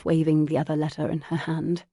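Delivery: usual speaking voice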